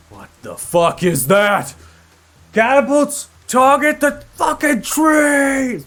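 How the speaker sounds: full-force, drawn-out high shout; commander yelling. Acted. Game master as commander yelling at the catapult crews, shouting. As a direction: bellow orders across a battlefield. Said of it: "The commander now yells outwards".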